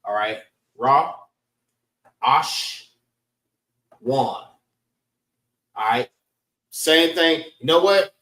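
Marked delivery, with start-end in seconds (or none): 0.8-4.4 Ra... Ash... Wan, long pauses between; teacher isolating Ra, then Ash, then Wan; syllable by syllable. Shown as a demonstration. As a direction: sound out Ra, Ash, Wan very separately. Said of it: "also learn the syllables".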